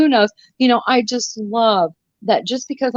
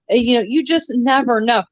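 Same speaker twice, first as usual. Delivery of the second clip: ordinary talk, nothing marked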